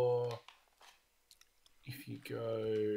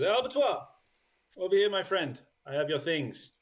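much higher, hearty call across the tavern; friendly bartender; 7 semitones higher, 3 dB louder than usual. Acted. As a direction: bartender waving his friend over.

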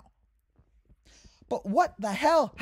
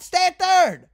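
frustrated yell; 7 semitones higher, 7 dB louder, slower than usual. Real, not acted. Yelling at the baserunner.

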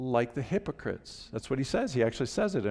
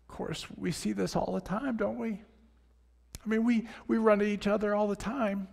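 moved, voice pinched with feeling; real emotion catching in the throat; choked up; 7 semitones higher than usual. Real, not acted. Moved preacher praying about judging.

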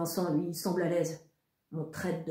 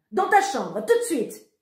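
loud, higher angry command; angry order; 7 semitones higher, 6 dB louder than usual. A narrator's reading. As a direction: send him to his room, shouting. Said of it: "crie maman". (ordre crié, aigu et fâché)

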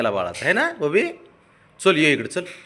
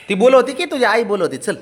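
loud coaxing call, raised pitch; 9 semitones higher, 6 dB louder than usual. Real, not acted. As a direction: call him loudly to come. Coaxing sulking pet to come. (जोरात हाक मारत)

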